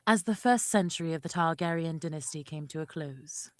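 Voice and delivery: put-on low British accent, like a solemn prologue. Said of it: "that's a good accent".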